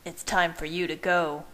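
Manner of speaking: ordinary delivery, nothing marked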